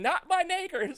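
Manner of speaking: high, loud yelled protest; mock scream. Storyteller screaming as he is beaten before onlookers, mock scream. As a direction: scream it out as a desperate explanation. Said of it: "I'm screaming".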